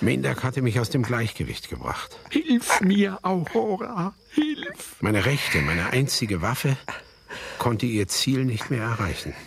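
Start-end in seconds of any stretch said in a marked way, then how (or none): 2.3-5.0 much higher, strained cry for help; desperate cry. Acted. Old man grabbed by the hair crying to his dead wife, desperate cry. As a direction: call out in panic as he is overpowered.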